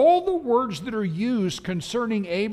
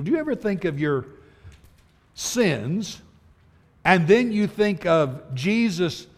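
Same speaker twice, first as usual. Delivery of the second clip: normal talking voice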